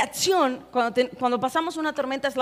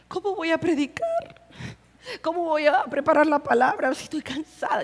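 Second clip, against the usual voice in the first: soft, tearful mock complaint to God; hammed-up weepy whine, trailing softer; imitated crying; 4 dB quieter than usual. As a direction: plead as if crying, half laughing. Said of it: "llorando y le decía".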